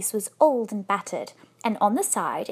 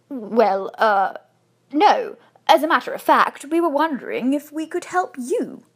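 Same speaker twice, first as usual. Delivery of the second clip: louder, halting start, then explaining; embarrassed; 3 semitones higher, 5 dB louder than usual. A narrator's reading. Husband answering the bear's offer of help, embarrassed. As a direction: stumble awkwardly into a polite answer. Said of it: "looked rather embarrassed".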